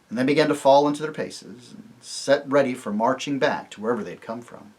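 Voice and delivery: ordinary delivery, nothing marked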